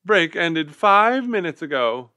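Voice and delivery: high, loud teacher rebuke; huffy. A narrator's reading. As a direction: snap at them huffily. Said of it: "she asked crossly".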